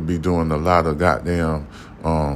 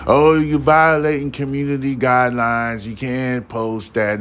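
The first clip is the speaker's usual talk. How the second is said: mocking the platform moderators; 6 semitones higher than usual. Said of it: "They like to harass me".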